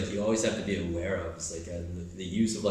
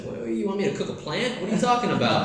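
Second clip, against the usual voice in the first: high, loud puzzled outburst; incredulous high protest; mock bafflement; 10 semitones higher, 5 dB louder than usual. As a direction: act confused and exaggerated.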